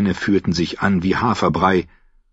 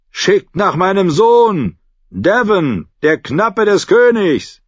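higher and louder, hands cupped round the mouth; calling up; 11 semitones higher, 5 dB louder, slower than usual. Acted. Davos calls up to the guard on the wall. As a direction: call up to the gate.

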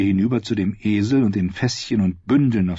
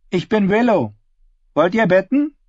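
put-on higher girl's voice, businesslike; a girl on the porch sizing up strangers, higher and guarded; wary ten-year-old Willow; 11 semitones higher, 4 dB louder than usual. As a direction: sound like a watchful child running the inn. Willow greets guests.

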